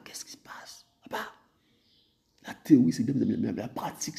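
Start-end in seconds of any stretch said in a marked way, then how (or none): 0.0-1.2 breathy, hushed plea; whispered prayer. Retold or imitated. Believer praying quietly in confusion, whispered. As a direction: barely voice it to the father. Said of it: "On se dit, Père".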